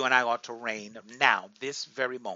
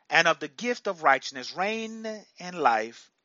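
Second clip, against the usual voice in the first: usual speaking voice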